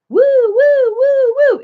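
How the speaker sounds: high-pitched "woo woo" fire truck siren; silly siren noise. Shown as a demonstration. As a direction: wail like a toy fire engine. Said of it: "when you make silly noises".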